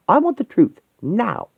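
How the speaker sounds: high, forceful demand; angry demand. Acted. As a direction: push the pitch up and insist. Demanding the truth in a quarrel.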